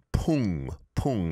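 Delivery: pung with a clear nasal ung ending; model pronunciation. Shown as a demonstration. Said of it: "it's an iung".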